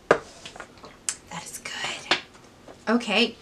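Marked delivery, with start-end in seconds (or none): 0.1-2.2 slow savouring whisper. Real, not acted. After a sip of coffee, slow whisper. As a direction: breathe it out slowly, savouring.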